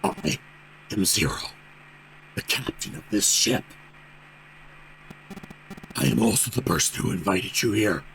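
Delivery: low, half-whispered sinister voice; cold eerie voice. Acted. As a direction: speak low and cold, like a menacing captain. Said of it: "The voice was harsh".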